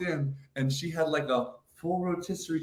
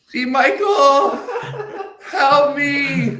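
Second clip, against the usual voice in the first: high, loud mock cry for help; hammed-up grandma shouting from upstairs; grandma calling for help; 11 dB louder, much slower than usual. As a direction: call out like a frail grandma in trouble. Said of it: "And I hear".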